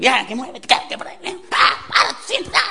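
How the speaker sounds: high, hammed-up parent yelling; shouted orders. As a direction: bark rapid orders at the children. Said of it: "órdenes a gritos".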